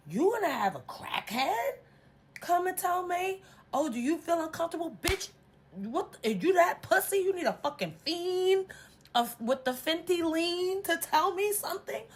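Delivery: high, loud, indignant retold rant; outraged telling-off, voice shooting up; irate confrontation. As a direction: tear into a friend at high pitch. Retelling her angry confrontation.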